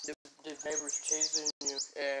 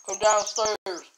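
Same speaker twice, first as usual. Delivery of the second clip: loud high call upstairs, repeated; yelling across the room; 7 semitones higher, 10 dB louder, much slower than usual. Real, not acted. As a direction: shout for her to come down. Calling sister from downstairs.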